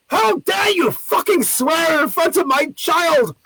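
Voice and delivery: high, slow, emphatic mock fury; imitating an enraged parent defending a child; outraged parent. Retold furious parent confronting lady.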